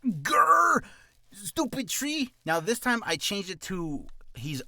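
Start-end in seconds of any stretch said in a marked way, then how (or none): 0.0-2.3 high-pitched mock growl of annoyance. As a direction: growl loudly, then grumble high and slow. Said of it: "It was just me going".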